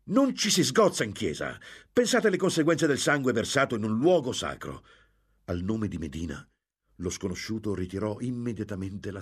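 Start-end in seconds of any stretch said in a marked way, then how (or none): 0.0-4.8 high, loud, urgent remonstrance; shouted protest. A narrator's reading. Don Cristobal stopping a duel in church, shouting. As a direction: shout them down, keeping it high. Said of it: "gridò".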